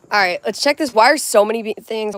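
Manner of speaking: shouting at the chat without meaning to; real unintended yell reading comments. Real, not acted. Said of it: "Why am I yelling?".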